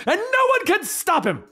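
high-pitched mock yell; hammed-up, high-pitched boast; defiant outburst. A narrator's reading. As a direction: burst out in comic defiance. Narrator bursts into the hero's defiance.

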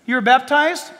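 ordinary delivery

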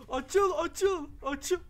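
desperate yelling to make it open; frantic shout. Real, not acted. Player yelling at a stuck door, frantic shout.

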